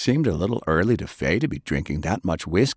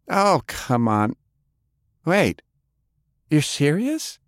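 Delilah; 9 semitones higher, slower than usual. Acted. Delilah laughs off compliment.

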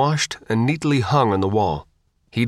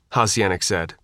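ordinary delivery

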